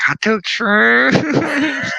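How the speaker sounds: very high, very slow yelled announcement; gleeful shout. Retold or imitated. Telling Matt, high drawn-out giddy shout. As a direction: belt it out, stretched and giddy. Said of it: "And I'm like".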